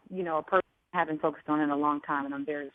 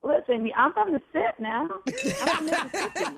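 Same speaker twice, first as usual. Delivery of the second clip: playful tough talk; 11 semitones higher, 3 dB louder than usual. Acted.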